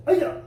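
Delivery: sharp high battle yell; kiai shout. Shown as a demonstration. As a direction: shout it from the gut like a strike.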